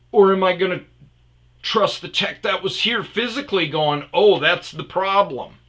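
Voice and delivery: high, emphatic, incredulous arguing; heated customer making a case; retold indignant challenge. Retold furious challenge to support.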